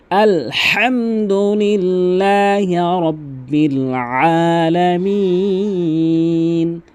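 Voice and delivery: slow melodic tilawat; Quran recitation. Acted. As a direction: chant it with long held vowels.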